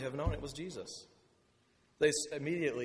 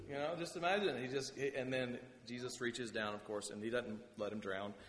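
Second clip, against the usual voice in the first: ordinary talk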